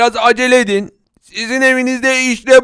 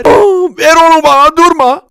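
much higher and louder, tearful; greedy demand; 6 semitones higher, 6 dB louder than usual. Acted. The boss whines at the cook to hand over the hamburger. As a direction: grab for it with a whine.

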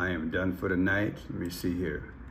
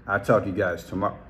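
ordinary delivery

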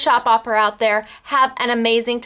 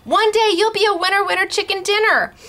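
singsong cheer; 7 semitones higher than usual. Real, not acted. Cheering on viewers.

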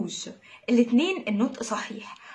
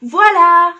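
triumphant voila; 6 semitones higher, 14 dB louder than usual. Real, not acted. Teacher cheers her students at the end of the alphabet, triumphant. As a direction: burst out with a loud, high "voilà!".

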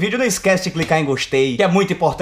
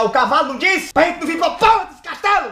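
exasperated yell; 10 semitones higher than usual. Pretend. Speaker yelling at the priest, a high exasperated shout. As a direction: yell it at him in disbelief. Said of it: "Eu ia olhar pro padre e ia falar".

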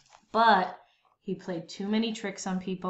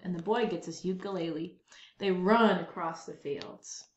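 ordinary delivery, nothing marked

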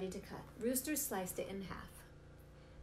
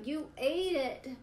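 slow, loud, high accusation; accusing cry; 6 semitones higher, 5 dB louder, slower than usual. Acted. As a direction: blame him, drawn out. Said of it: "cried Iguana".

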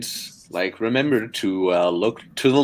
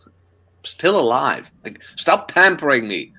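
mildly exasperated retort; 6 semitones higher than usual. Retold or imitated. Annoyed partner telling ex to stop pampering, mild exasperation. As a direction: push back with a touch of exasperation. Retold irritated answer to fussing ex.

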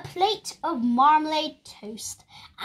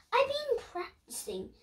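put-on high child voice; 14 semitones higher, 4 dB quieter than usual. Lester pleads with Mum.

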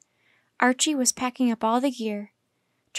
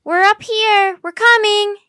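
loud, high answering shout; shouting back; 10 semitones higher, 13 dB louder, slower than usual. A narrator's reading. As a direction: yell back to someone down at the camp. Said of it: "Annie shouted".

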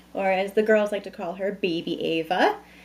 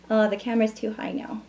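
normal talking voice

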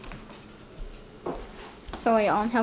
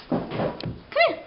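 usual speaking voice

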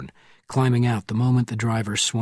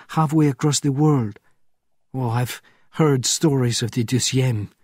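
a hint of a higher, pleading woman's voice; lightly voiced frightened woman; 5 semitones higher, 4 dB louder than usual. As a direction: voice the nun begging to help, urgent. Lavier voiced in scene.

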